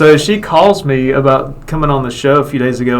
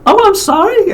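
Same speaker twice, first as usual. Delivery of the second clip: very high, mock-apologetic squeak; chipper woman imitation. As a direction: mimic her apology in a high falsetto. Acts out Lauren's chipper apology.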